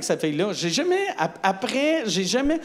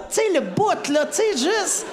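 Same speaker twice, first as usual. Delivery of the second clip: high pleading; 9 semitones higher than usual. Retold or imitated.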